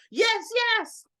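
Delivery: high, emphatic yes with a punch on the second; enthusiastic yes. Retold or imitated. Imitating conference goers calling out an excited yes. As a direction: echo an audience member fired up by the speaker. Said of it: "you could hear bursts of".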